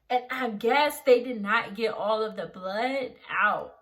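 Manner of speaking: usual speaking voice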